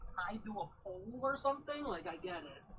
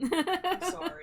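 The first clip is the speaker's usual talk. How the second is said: squeaky apology; 10 semitones higher, 9 dB louder, slower than usual. Acted. Mock apology in put-on voice.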